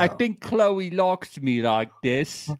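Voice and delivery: camp up a Chloe impression; Chloe's British accent. Host imitating Chloe's accent for co-host. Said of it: "do I sound like Chloe".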